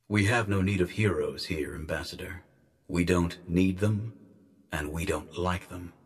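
quiet, low, cold refusal; disembodied guardian voice. Acted. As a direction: rebuff the envoy softly and deliberately.

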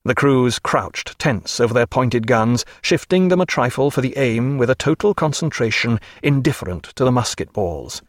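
plain reading voice

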